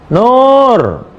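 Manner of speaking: high call of a mother from another room. Acted. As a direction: call the daughter's name across the house. Mother calling daughter at prayer.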